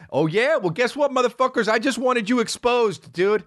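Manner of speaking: high, gloating mock villain taunt. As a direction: gloat over the exposed viewers.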